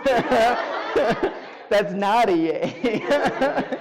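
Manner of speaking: a loud fake yay breaking into laughter; cheer, then laugh at yourself; mock cheer laughing. Real, not acted. Speaker mock-cheering a crushed dream, then laughing.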